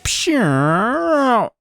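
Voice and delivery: high, swooping vocal whoosh; imitated song noise. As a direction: mimic the song's sound with a rising-falling wail. Imitates the song after a win.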